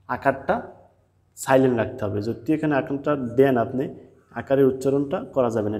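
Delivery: normal talking voice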